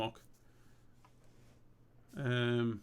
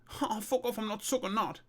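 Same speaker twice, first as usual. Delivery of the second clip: high-pitched scoffing protest; indignant refusal; 9 semitones higher than usual. Acted. Mate refusing his pal's suggestion, indignant.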